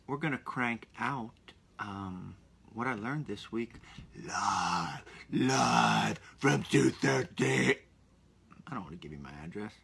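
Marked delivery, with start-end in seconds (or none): 4.3-7.8 slow, drawn-out show intro; mock announcer. To podcast listeners, a slow mock announcer intro. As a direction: announce it like a broadcast opener.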